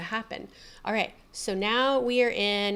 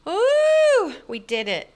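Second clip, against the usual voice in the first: squealing joy, then laughing; rowdy party animal; 10 semitones higher, 8 dB louder, slower than usual. Real, not acted. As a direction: shriek with delight. Success after long wait.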